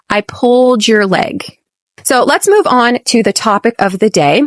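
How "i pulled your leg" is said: "I pulled your leg" with "pulled your" joined, stress on "pulled"; linked in full sentence. Shown as a demonstration. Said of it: "You can connect those".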